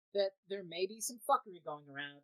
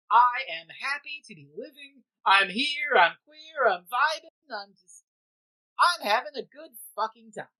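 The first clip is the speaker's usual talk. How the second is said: channel the Doctor's infectious joy, loud and bright; joyful imitation; 3 semitones higher, 10 dB louder than usual. Imitating the Doctor's joy for podcast cohosts, excited. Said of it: "This infectious joy".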